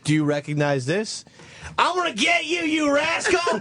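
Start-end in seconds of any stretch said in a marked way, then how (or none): 1.8-3.6 high shouting southern cartoon villain; Perry Rose voice. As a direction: yell it like a hammy southern villain. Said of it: "That's the voice of Mister Perry Rose".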